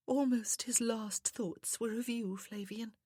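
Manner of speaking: high, slow, sweet feminine voice; soft, sweet woman. A narrator's reading. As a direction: speak as a sweet, grieving lady. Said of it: "how soft and sweet her voice was".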